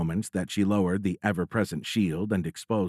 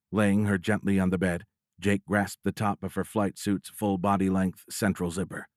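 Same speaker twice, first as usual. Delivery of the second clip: ordinary delivery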